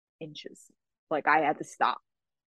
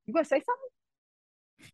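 pointed challenge; 9 semitones higher, 5 dB quieter than usual. Retold or imitated. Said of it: "I looked at the coach and I went".

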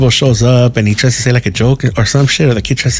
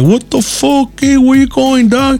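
tough homie voice; 13 semitones higher, slower than usual. Retold or imitated. [name] teasing how he'd talk to the kids, with a high, slow homie voice. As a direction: lay it on like a rowdy homie yelling at kids. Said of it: "I'm gonna talk to the kids like that".

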